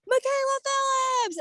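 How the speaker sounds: high, slow announcing of a name; Tyra Banks announcing. Retold or imitated. Host calls out the contestant's name, drawn out and high. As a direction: call the winner's name like a show host. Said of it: "Tyra Banks is like".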